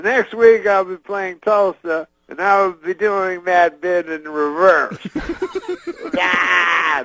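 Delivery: Cosby closing the bit to the crowd, high and slow; Bill Cosby impression. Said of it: "at the end he goes".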